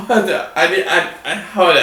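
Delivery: ordinary talk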